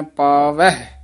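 ਪਾਵਹਿ with the ending as ਪਾਵੈਹ; ਪਾਵਹਿ ending in a ਹ sound; careful ਪਾਵਹਿ; ਪਾਵਹਿ said right. Shown as a demonstration. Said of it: "ਇਹਨੂੰ ਪਾਵਹਿ ਉਚਾਰਨ ਕਰਨਾ ਹੈ".

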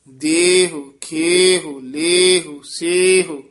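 ਦੇਹੁ, ਖੇਹੁ, ਲੇਹੁ, ਸੇਹੁ said crisply with a short hu ending; clear drill. Shown as a demonstration. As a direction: drill each word clearly, one by one. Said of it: "ਲੱਗ ਜਾਂਦਾ ਹੈ ਦੇਹੁ".

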